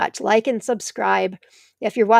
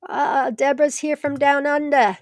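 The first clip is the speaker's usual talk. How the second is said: put-on a quick, playful British accent, pitched a bit higher; 5 semitones higher than usual. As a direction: toss off a light British accent as a greeting. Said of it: "That was a British accent I think I just did".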